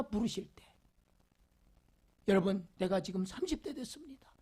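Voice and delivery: ordinary delivery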